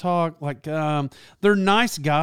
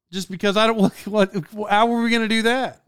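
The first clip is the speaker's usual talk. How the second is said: ordinary delivery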